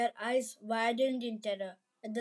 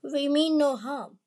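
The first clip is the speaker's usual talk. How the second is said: mildly nervous, higher-pitched plea; a touch of quivering resolve, raised pitch; trembling but determined; 5 semitones higher, 4 dB louder than usual. A narrator's reading. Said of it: "his voice trembling but determined".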